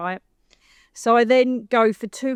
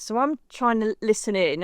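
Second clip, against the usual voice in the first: ordinary talk, nothing marked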